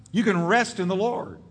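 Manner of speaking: ordinary talk, nothing marked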